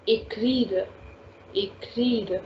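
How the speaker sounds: "écrire" run together, "ay-kreer"; A sound for é. Shown as a demonstration. Teacher repeating the example for students, "écrire" joined as ay-kreer. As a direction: model "écrire" as one word with a clear "ay". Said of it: "we need to give A sound".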